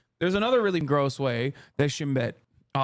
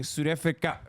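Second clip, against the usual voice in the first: South African accent practice; 3 semitones lower, 4 dB quieter than usual. Shown as a demonstration. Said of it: "try saying".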